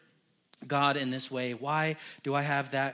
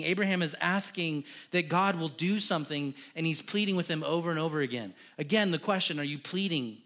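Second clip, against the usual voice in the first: usual speaking voice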